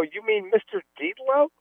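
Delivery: high-pitched incredulous query; retold disbelief. Player checks who the guys mean, high incredulous retelling. Acting out own disbelief in story.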